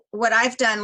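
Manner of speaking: usual speaking voice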